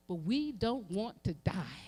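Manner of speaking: ordinary talk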